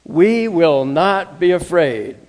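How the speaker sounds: loud, slow, every word weighted; declared with the congregation. Shown as a demonstration. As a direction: lead the room in a loud slow chant. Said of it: "Can you say that with me?".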